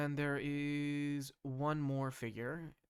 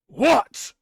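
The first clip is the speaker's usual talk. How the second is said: much higher, loud barked what; gruff orc shout; 21 dB louder than usual. Acted. Orcish council member yelling at the newcomers. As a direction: bark it at the intruders.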